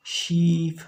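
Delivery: "शीप" with a long, drawn-out ee; long ई sound. Shown as a demonstration. Teacher showing students the long vowel, drawn-out ई in "शीप". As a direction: stretch the ई in "शीप". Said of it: "ये तो lengthy है".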